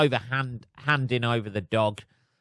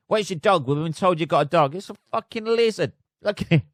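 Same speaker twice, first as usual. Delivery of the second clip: higher-pitched, lively mock questioning; acted exchange over dog; 6 semitones higher, 3 dB louder than usual. Officials question an owner about a disguised dog, higher-pitched acted exchange. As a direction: play out the doorstep exchange, pitched up.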